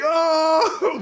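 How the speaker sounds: high, loud mock "Oh" cry; exaggerated retold wail; hammed-up cry. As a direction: shout it like a crowd groaning. Group cries out at the scene.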